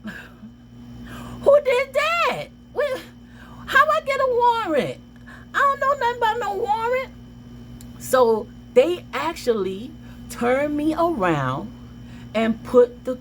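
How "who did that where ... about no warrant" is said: much higher, a cracking near-cry of disbelief; shocked disbelief. Retold or imitated. Teen told she has a warrant, shocked squealing protest. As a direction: squeal it out like the world just flipped.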